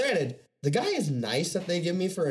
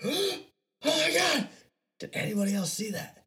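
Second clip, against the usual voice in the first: high gasping amazement; high startled wonder; imitating himself high on mushrooms, amazed; tripping amazement; 12 semitones higher than usual. Tripping, seeing TVs flash.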